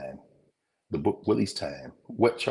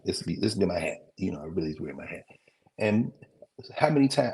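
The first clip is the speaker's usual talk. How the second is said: normal talking voice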